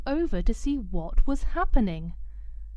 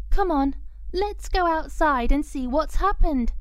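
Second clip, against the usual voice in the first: put-on girl's voice; 6 semitones higher than usual. Girl urging her dog.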